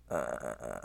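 stumbling hesitant stutter. Real, not acted. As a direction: trip over the start. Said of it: "I'm stuttering".

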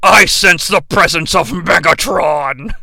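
slow, low, booming robot leader; mock-epic deep commander voice; Optimus Prime. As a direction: intone it slowly like a heroic robot. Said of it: "Optimus Prime, out of nowhere".

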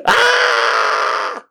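very high, drawn-out cry; acted-out squeal of pain; pained wail. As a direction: wail into the chair. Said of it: "I'm pushing my face into the plastic hole".

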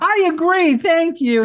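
high, loud burst of agreement; delighted agreement. Real, not acted. Host to guest who picked the same winner, delighted.

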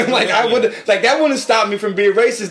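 loud laughing rant. Real, not acted. Laughing while ranting.

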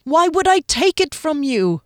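accused man insisting; emphatic. A narrator's reading. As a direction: insist hard. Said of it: "Frederick said emphatically".

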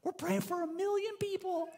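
put-on a kid dreaming big to God; eager little kid. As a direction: pray it like an excited seven-year-old. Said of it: "this little seven-year-old goes".

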